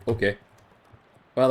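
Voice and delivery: usual speaking voice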